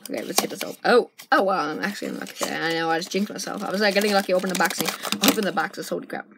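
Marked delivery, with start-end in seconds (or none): none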